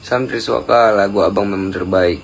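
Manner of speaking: normal talking voice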